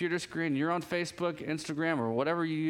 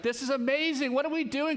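excited wife; 7 semitones higher than usual. Retold or imitated. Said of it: "my wife's like".

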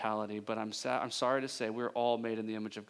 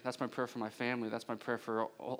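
ordinary talk

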